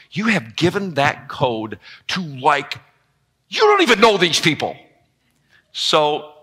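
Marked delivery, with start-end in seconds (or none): 0.1-4.7 mock-scolding wife. Wife scolding husband about strangers, hurried and rising. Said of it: "She goes".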